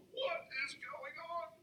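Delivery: high, soft, drawn-out mock bewilderment; bewildered. Naive young man watching his friend unload, quietly bewildered. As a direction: squeak it slowly, as an inner aside. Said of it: "I'm just sitting there going".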